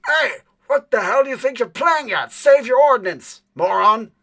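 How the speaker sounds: high, loud yell from far off through the woods; furious distant yell, voice cracking high; distant angry shout. Acted. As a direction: bellow it across the woods in anger. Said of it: "you would hear through the woods a distant voice".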